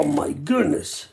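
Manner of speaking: ordinary talk, nothing marked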